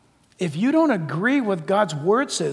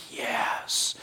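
whispered; 2 semitones higher, 9 dB quieter than usual. Retold or imitated. Believer's quiet response.

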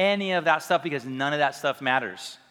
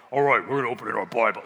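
lower, quicker mock-deep preacher voice; cool deep voice; 6 semitones lower, faster than usual. Preacher jokes about wishing for a deep voice, faking a lower, quicker one. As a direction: fake a cool, booming pastor. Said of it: "I wish I could have a cool, deep voice".

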